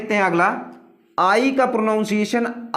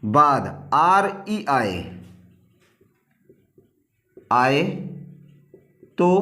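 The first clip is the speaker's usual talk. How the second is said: slow dictation with long pauses between phrases; 5 semitones lower, slower than usual. Acted. Teacher dictating the rule for students to copy down, slow and spaced.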